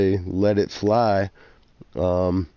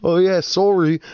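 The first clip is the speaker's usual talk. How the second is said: imitated Canadian accent, higher and louder; 10 semitones higher, 4 dB louder than usual. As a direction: mimic a Canadian sorry, pitched up. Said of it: "with the Canadian accent".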